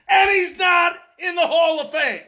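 high-pitched, indignant protest; indignant outburst. Real, not acted. Indignant rant over a snub.

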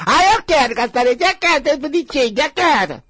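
high, loud spoiled whine; hammed-up tantrum voice; mock childish demand. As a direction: whine "I want" like a bratty kid. Imitates a childish person demanding.

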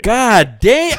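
high, loud disbelief with laughter; real shocked outburst. Real, not acted. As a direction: exclaim at how late it was. Hears he was two hours late.

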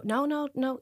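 retold quick "nothing to worry about" voice; urgent reassurance. Brother soothing child on phone, retold.

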